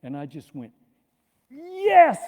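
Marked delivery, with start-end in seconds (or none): 1.5-2.2 "Yes!" yelled high and loud in triumph; a victory whoop after surviving the fall; triumphant shout. Retold or imitated. Skier to the whole mountain, triumphant yell. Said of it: "like that".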